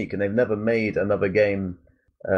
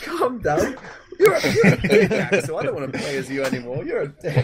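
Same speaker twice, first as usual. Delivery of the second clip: mock quarrel with a game character, laughing and high-pitched; 7 semitones higher than usual.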